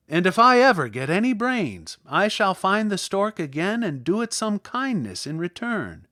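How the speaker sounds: a hint of a cheerful straw man; scarecrow voice. A narrator's reading. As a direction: speak with relieved, happy gratitude.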